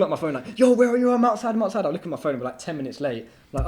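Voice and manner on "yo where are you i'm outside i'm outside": impatient caller. Retold or imitated. Driver calling impatiently on phone.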